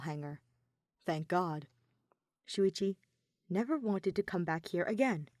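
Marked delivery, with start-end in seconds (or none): none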